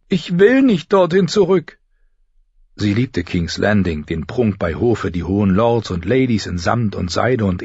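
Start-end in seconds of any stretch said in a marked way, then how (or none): 0.1-1.8 put-on high, loud girl's plea; imploring. Sansa begs father not to send her back, imploring. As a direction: beg in a raised, high voice. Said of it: "flehte Sansa ihn an".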